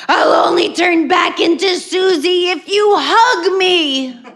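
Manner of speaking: high, slow, loud monster plea; hammed-up monster voice. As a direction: bargain like a spooky monster. Sister as monster bargaining.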